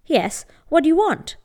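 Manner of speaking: cold, haughty snap. Acted. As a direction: look down on her as you ask. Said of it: "addressed her haughtily".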